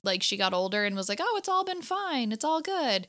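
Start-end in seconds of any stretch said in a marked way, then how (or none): 1.2-3.1 high-pitched, airy brush-off; mock breezy reassurance. Imagining her older, dismissing the curse, mock breezy reassurance. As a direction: wave it all away in a raised voice.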